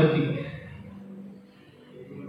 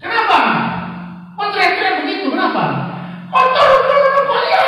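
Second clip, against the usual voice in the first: much higher, loud frantic shriek; screaming mother; 3 dB louder, faster than usual. Retold or imitated. The child's mother screaming at the uncle, high and loud. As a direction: scream at the driver in panic. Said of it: "mama dari anak itu berteriak".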